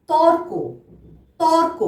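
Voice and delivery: teacher modelling তর্ক with a clear র; model pronunciation. Shown as a demonstration. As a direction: sound র clearly before ক in তর্ক. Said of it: "আমরা কিভাবে উচ্চারণ".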